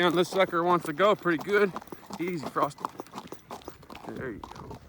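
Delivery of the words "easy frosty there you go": soothing the horse. Real, not acted. Calming the horse.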